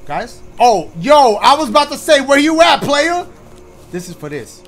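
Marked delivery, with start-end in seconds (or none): 0.6-3.3 loud, high relieved shout to a friend; raised voice finding a lost buddy; excited call. Real, not acted. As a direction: yell out, glad he's back. Relieved to find friend again.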